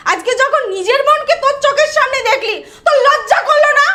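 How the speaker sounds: high, loud outraged scolding; furious shouting. Acted. Sister confronting brother over his shamelessness, furious shouting.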